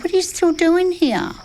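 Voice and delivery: high, sharp retold question; high startled demand. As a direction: ask it high and startled as if you've just seen them there. Startled woman confronts men at night.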